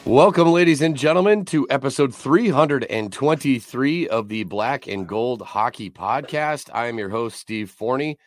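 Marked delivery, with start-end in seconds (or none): 0.1-6.7 announcer intro. Acted. Podcast intro announcer welcome.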